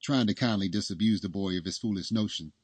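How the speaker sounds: plain reading voice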